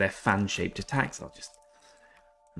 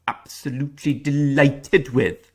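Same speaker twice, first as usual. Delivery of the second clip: put-on quick jokey Welsh accent; 5 semitones higher, 5 dB louder than usual. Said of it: "That's my Welsh accent".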